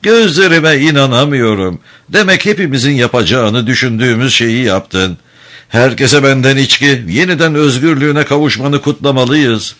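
smiling welcome. A narrator's reading. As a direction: welcome him with show of delight. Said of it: "geniş bir gülümsemeyle". (geniş bir gülümsemeyle)

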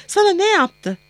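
high-pitched, anxious question; alarmed mother. A narrator's reading. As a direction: demand an answer in rising alarm. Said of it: "Telaşı elle tutulur cinstendi".

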